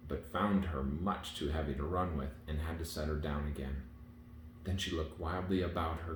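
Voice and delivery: usual speaking voice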